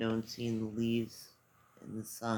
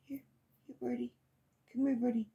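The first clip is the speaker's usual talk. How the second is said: high sweet call to a bird; coaxing a wild bird; 8 semitones higher, faster than usual. Real, not acted. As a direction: coax the birdie closer. Calling to a bird.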